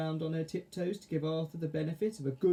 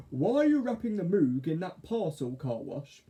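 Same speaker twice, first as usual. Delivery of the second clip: puzzled, higher caterpillar; 4 semitones higher, 4 dB louder than usual. Acted. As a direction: ask it, baffled. Arthur character voice.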